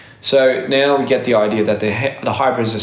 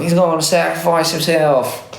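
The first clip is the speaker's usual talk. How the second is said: higher-pitched mock-dramatic movie line; meme quote; 5 semitones higher than usual. Repeating the meme that ruined the movie, mock-dramatic.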